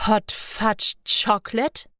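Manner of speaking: slow, puzzled "Hot Fudge Chocolate" rising at the end; baffled repeat. A narrator's reading. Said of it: "wiederholte er". (ungläubig und langsam wiederholt "Hot Fudge Chocolate")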